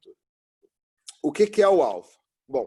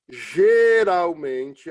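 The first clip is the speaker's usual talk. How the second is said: "Geralmente" stretched very slow and louder, rising at the end; drawn-out stress; 2 semitones higher, 7 dB louder, much slower than usual. Shown as a demonstration. Teacher to the class, flagging the key word: "Geralmente" stretched slow and loud. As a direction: lean hard on "Geralmente" and drag it out. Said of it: "tem a palavra geralmente?".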